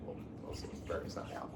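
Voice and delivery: a sly muttered aside; hushed, breathy afterthought; whispered aside. Real, not acted. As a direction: whisper it under your breath. Aside after teasing Andrew.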